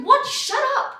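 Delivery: high, loud comic protest; mock outrage. Woman to persistent German date, exasperated mock shout. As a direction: shut him down, hammed-up.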